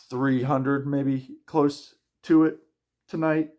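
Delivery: drowsy, words dragging out slowly; half asleep. Real, not acted. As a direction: talk through a yawn, barely awake. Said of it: "I'm sorry, I'm half asleep".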